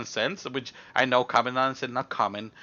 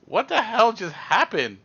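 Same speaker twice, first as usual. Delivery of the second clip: higher, slow, stunned question; bewildered; 6 semitones higher, slower than usual. Retold or imitated. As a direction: ask it in drawn-out bewilderment. Stunned, asking himself in a retold story.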